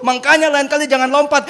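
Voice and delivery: loud, high-pitched demand; scolding father. Acted. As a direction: shout it as an alarmed dad.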